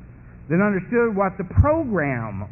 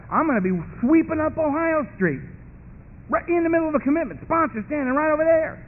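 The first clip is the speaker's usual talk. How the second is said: high-pitched, worked-up recounting; panicked imagining; 8 semitones higher than usual. Acted. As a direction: voice climbs as the scenario runs away. Imagines panicked relapse at commitment.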